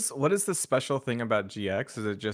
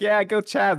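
cheering sidekick; 10 semitones higher, 7 dB louder than usual. Retold or imitated. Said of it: "going like".

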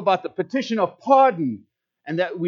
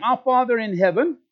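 leading prayer recitation; 5 dB louder than usual. Shown as a demonstration. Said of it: "Starting with me saying".

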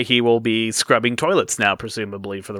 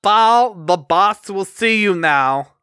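stern boss voice; 8 semitones higher, 3 dB louder, slower than usual. Acted. Boss addresses Ball.

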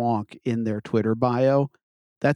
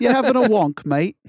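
high, loud London geezer taunt; mock London accent; 9 semitones higher, 4 dB louder than usual. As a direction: bark it like a cheeky London lad. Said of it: "transatlantic accent over there?".

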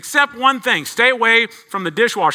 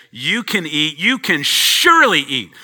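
"surely" leaned on, louder; stress on surely; 5 dB louder than usual. Retold or imitated. Preacher quoting God's generous permission, stressing "surely". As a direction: hit the word "surely" hard. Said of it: "God said".

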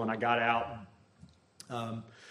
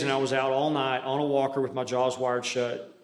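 ordinary talk, nothing marked

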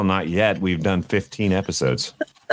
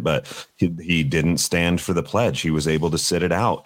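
normal talking voice

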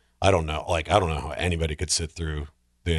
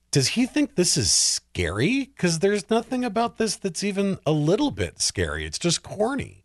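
pitched much higher and slowed, disbelieving; incredulous scoff; 12 semitones higher than usual. Retold or imitated. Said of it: "And I was like".

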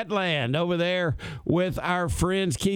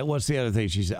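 usual speaking voice

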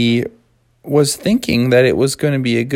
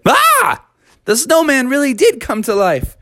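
high cry of shock; yelp, then exclaim in high amazement; startled alarm; 10 semitones higher than usual. Acted. Froggy and dad see a living snowman, high startled cry. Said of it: "they both said".

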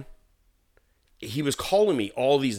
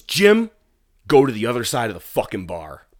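barked order; 2 semitones higher, 7 dB louder than usual. Retold or imitated. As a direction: order him off like a dog. Owner ordering his minion away.

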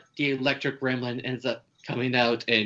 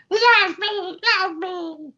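squeaky very high gremlin squeal, much higher and louder; female gremlin voice; 6 dB louder, much slower than usual. Retold or imitated. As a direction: shriek it like a lovesick little monster. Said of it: "the female gremlin was saying".

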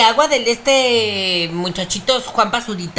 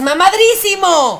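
mock shout; 9 semitones higher, 4 dB louder than usual.

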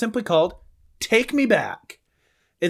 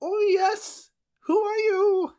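very high, put-on old-world voice; medieval peasant voice; 13 semitones higher, slower than usual. Man from the past questions a stranger, very high put-on voice. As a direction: squeak it like a frightened peasant from the past. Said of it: "I'm the one that has to do the accent".

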